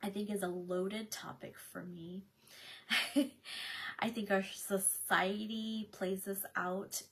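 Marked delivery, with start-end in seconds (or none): 2.5-3.9 brief breathy laugh; a quick self-aware laugh; short chuckle. Real, not acted. Host admitting a loaded topic, short chuckle.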